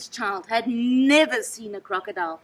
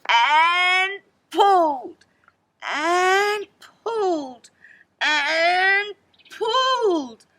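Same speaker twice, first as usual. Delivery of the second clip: slow, strained, higher effort voice; straining; 7 semitones higher, 7 dB louder, much slower than usual. A narrator's reading. Narrator straining as the child pulls, effortful. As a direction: heave out each word.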